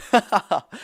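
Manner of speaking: short, real laugh; amused laugh. Real, not acted. Commentator laughing at fuming fans, amused laugh.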